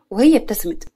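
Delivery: plain reading voice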